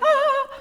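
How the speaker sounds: a sudden very high sung "ah", Disney village chorus; mock chorus "ah". Teacher mimics the Village Women chorus, a high sung "ah". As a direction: swoon like a cartoon village crowd. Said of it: "Beauty and the Beast Village Women".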